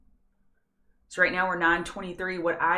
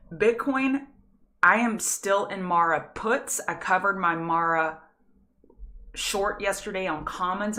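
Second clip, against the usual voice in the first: normal talking voice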